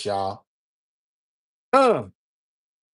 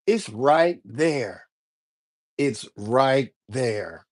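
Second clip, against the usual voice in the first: drawn-out, word by word; slow emphatic insistence; 2 semitones lower, much slower than usual. Real, not acted. Pointing viewers to the written rule, slow and emphatic. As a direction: spell it out very slowly.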